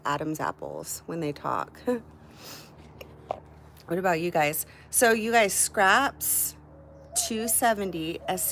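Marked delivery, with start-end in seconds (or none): none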